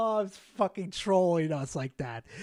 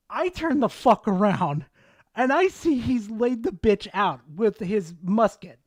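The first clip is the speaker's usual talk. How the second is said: ordinary talk, nothing marked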